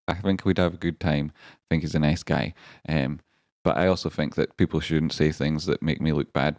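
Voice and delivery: usual speaking voice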